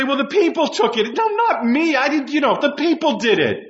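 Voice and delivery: high-pitched, protesting blame-shifter; Saul making excuses. Acted. Preacher acting out a king blaming the people, defensive. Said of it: "Saul's trying to say".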